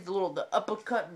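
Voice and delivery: plain reading voice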